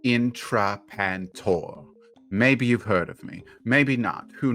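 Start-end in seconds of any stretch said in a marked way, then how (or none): 0.0-1.8 each syllable slowly spelled out. Acted. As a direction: say the name slowly, patronising. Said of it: "I'll say it slowly for you then".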